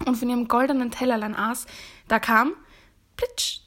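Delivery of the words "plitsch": high-pitched "plitsch" sound effect; short, very high wet slap; imitated splat. A narrator's reading. Frog hopping up the stairs, high splashy imitation.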